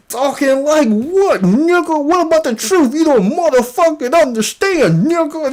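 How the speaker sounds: lightly voiced mock of the man in the wig; mocking imitation. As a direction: parrot his comeback with a mocking lilt. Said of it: "he said".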